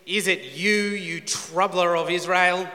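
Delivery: loud, drawn-out accusation; accusing. Acted. Ahab confronting Elijah, accusing. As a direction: throw it out forcefully, stretching the insult.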